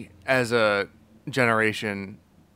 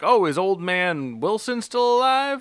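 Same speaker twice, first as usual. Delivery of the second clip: mock nostalgic old man; 8 semitones higher than usual. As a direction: drag it out, fondly asking about old friends. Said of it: "he was like".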